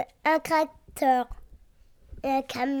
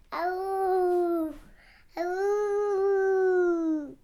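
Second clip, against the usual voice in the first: high playful wolf howl; 4 semitones higher than usual. Retold or imitated. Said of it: "J'ai fait le loup". (hurlement de loup)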